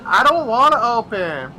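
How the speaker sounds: high, whining refusal; whiny coworker. Retold or imitated. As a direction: complain like you won't budge. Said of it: "It's like".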